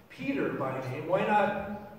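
ordinary delivery

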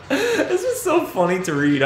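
real laughter, pitch jumping high; laughing. Real, not acted. Reader cracking up at their own accent, laughing. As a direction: talk through a fit of giggles.

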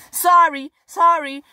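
sorry, pitched high and loud; a sudden, loud, high apology; quick loud sorry. Real, not acted. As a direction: blurt out a startled apology. Blurted apology after rude remark.

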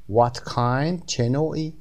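normal talking voice

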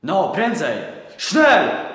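furious German command; impatient shout. Acted. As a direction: snap at the child to hurry. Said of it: "zniecierpliwił się Niemiec".

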